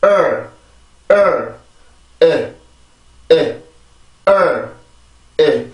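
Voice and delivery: strong first syllable, weak second; drilled "early" syllables. Shown as a demonstration. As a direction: stress on 을, light 에. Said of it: "다시 해봅시다".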